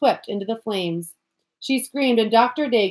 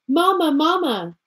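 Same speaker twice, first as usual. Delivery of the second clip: calling out louder, higher; more loudly; 6 semitones higher, 3 dB louder than usual. A narrator's reading. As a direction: call out for her mother. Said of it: "then more loudly".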